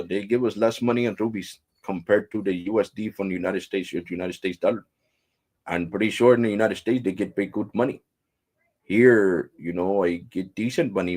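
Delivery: put-on explaining in an Indian accent; mimicked Indian English phone manner; imitated Indian agent. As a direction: explain patiently as an Indian call-centre worker. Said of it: "I'm talking like he was talking to me".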